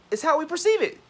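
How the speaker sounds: very high, loud goofy voice; hammed-up high-pitched silliness; silly voice. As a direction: squeal it out stupidly. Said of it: "I'm being stupid".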